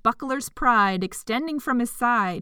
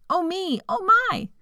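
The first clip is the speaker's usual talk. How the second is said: surprised; 9 semitones higher than usual. A narrator's reading. Excited exclamation introducing O.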